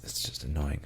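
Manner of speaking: usual speaking voice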